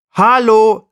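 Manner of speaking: polite tiny flea. Acted. The glacier flea politely greets the jackdaw, high and small. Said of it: "sagt der Gletscherfloh höflich".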